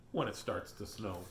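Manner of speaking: ordinary delivery, nothing marked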